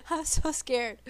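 nervous laugh on so scared. Real, not acted. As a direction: giggle it out nervously. Repeats while laughing.

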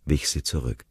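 usual speaking voice

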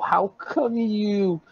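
high, slow tearful stammer; acted hurt disbelief. Nick reacts to Judy's correction, acted hurt disbelief. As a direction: draw it out like a wounded lover.

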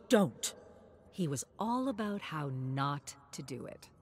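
plain reading voice

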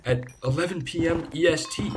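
high, emphatic retold reply; insistent telling. Streamer retelling his reply to viewers, insistent. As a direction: pitch it up and press the point. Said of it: "I keep telling them".

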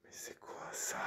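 soft puzzled whisper; voiceless uneasy question. Real, not acted. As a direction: whisper so nothing hears. Hushed in a dark house.

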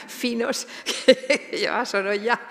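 real chuckle breaking up the phrase; amused laughing. Real, not acted. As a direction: laugh through the words, genuinely amused. Amused by the painter's fine little shoes.

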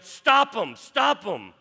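shouted high and loud stop 'em; retold yell. Commissioner orders police to stop marchers: loud angry yell. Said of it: "he yelled".

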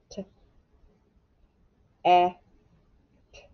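"T-, e-, t-." as isolated sounds with long gaps; sounding out. Shown as a demonstration. Teacher writing the second syllable, "T-, e-, t-." sounded out one by one. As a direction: give each sound of "T-, e-, t-." on its own.

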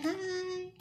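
high, tiny puppet voice; squeaky puppet voice. Acted. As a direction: make the bunny say hi. Bunny puppet says hi.

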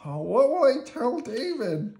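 high, anguished puppet wail; loud, despairing question; Bert. A narrator's reading. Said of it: "Bert wondered".

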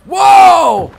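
loud high whoa; startled shout. Real, not acted. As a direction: yelp in surprise. Sudden game event.

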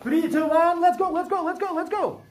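high-pitched rallying start call; cheering the class into action; excited countdown. Real, not acted. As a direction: urge them off with rapid repeats. Teacher starts a race in class.

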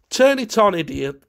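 impatient command to his friend. A narrator's reading. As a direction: order him to switch it on. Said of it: "commanded his friend".

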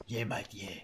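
raspy smoker's croak, low and rough; smoker's cough voice. Retold or imitated. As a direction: rasp it out like a lifelong smoker. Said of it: "Super Smoker's cough voice".

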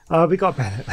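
laughing through the words, giggle rising high. Real, not acted. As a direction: giggle at the funny luck. Lucky bonus character.

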